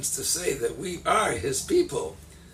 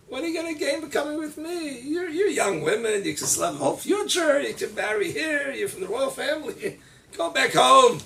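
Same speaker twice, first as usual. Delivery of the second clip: put-on higher, gently urging older woman; lightly voiced Naomi; 10 semitones higher than usual. Naomi urging her daughters-in-law, lightly higher. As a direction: persuade them to go home.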